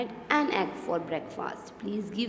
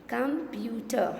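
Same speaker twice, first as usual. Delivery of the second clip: "Computer" slowly as com, pu, ter; teacher sounding out "Computer" slowly; split into syllables; slower than usual. Shown as a demonstration. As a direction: say "Computer" in three separate beats. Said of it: "How many syllables?".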